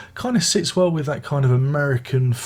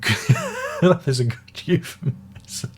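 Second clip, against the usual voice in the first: laughing; 6 semitones higher than usual. Real, not acted. Laughing at his own euphemism.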